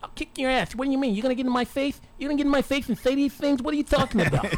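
high lisping boxer threat; Mike Tyson impression. Host doing his best impression on request, high lisping Tyson threat. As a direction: lisp it high and indignant. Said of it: "Which one, Mike Tyson?".

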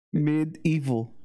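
Medieval drawn out slowly, syllable by syllable; slow careful pronunciation. Shown as a demonstration. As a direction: spell out Medieval slowly as meh-dee-ee-vul. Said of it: "Sounds like E T and shit".

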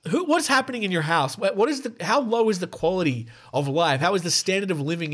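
high-pitched, appalled questioning; mock incredulous. Host questions the monkey owner's household, mock incredulous. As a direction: play baffled outrage, stumbling over the questions.

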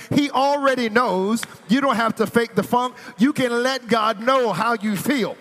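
high, loud, driving sermon voice; full-force preaching. Acted. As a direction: preach it hard to the congregation.

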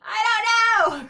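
very high, loud, drawn-out wail; slow high holler; crying out. A narrator's reading. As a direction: stretch it into a long panicked cry. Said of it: "cried Lil".

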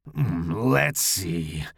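low rough growl. A narrator's reading. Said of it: "Larry growled".

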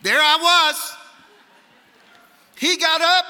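loud, high dramatic preacher delivery; forceful pulpit storytelling; preaching. Real, not acted. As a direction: punch each short phrase out to a big hall.

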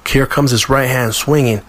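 ordinary delivery

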